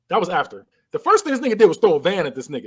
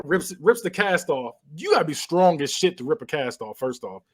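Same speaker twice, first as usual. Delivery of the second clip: usual speaking voice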